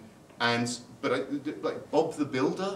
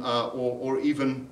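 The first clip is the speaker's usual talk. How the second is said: usual speaking voice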